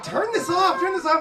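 mock panicked wife; wife yelling. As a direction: turn it off. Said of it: "my wife is like".

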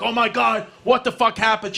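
retold alarm, pitch jumping up; shocked outburst. Son sees his mother's bloody knee, shocked outburst.

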